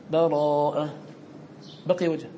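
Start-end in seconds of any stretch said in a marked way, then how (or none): 0.1-0.9 fresh start on Bara'a in a recitation voice; recited after a breath. Shown as a demonstration. Teacher starts Surat Bara'a after the stop, recited. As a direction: begin the new surah anew. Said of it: "تنفس الوقف".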